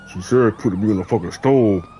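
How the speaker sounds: low imitation of a stranger; hidden man's voice. Unseen man's voice suddenly heard.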